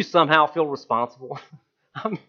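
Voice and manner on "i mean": laughing. Real, not acted. Laughs at own joke.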